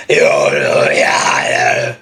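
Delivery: low, breathy scream without power; weak scream demo. Shown as a demonstration. Showing what not to do, a weak breathy scream. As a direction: hold back the push. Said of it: "So instead of being like".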